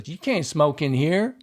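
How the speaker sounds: upset hospital worker. Shown as a demonstration. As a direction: say it like it bothers you. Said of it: "like you're upset about saying this".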